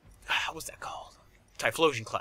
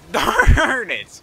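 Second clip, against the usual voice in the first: frustrated shout; 10 semitones higher, 15 dB louder than usual. Real, not acted. Frustrated at game.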